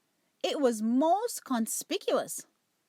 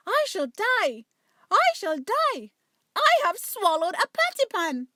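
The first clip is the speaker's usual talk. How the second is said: howling wail; 8 semitones higher, 6 dB louder than usual. Acted. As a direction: wail as if dying of fright. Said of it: "Duchess set up a howl".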